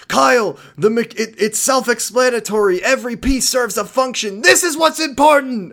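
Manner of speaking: high-pitched, hammed-up exasperated rant; overwrought outburst. Ranting at Kyle that every piece matters, overwrought. Acting out overwrought character in show.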